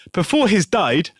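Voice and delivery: laughing friend quoting the scam email's best line, mock West African accent; mock broken-English quote. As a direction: savour the bad grammar in a West African accent. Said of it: "This bit's way better".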